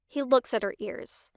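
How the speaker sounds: ordinary delivery, nothing marked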